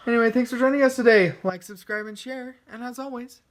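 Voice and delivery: fast, bright sign-off to viewers; quick cheerful host outro; upbeat outro. Real, not acted. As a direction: rattle off the goodbye like a host.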